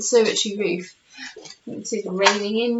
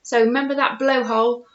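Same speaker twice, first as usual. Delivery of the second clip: usual speaking voice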